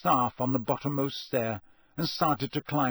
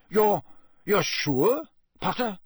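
higher, louder, stumbling question; alarmed; 7 semitones higher, 4 dB louder than usual. A narrator's reading. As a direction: press for confirmation, stammering on the first word. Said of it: "He looked suddenly alarmed".

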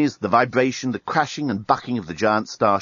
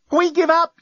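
much higher and louder, frantic; yell; 4 dB louder than usual. A narrator's reading. Lucy yelling surrender at the enemy fleet, higher and louder. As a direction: shout the surrender desperately. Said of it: "yelled Lucy".

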